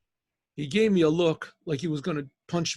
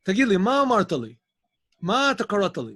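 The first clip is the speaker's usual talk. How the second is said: angry demand; 4 semitones higher, 6 dB louder than usual. Retold or imitated. Angry man confronting narrator.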